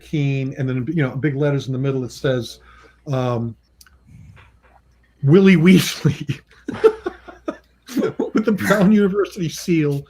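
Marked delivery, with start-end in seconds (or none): 5.2-10.0 laughing proudly. Real, not acted. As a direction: savour the name through a laugh. Reading the proud certificate line.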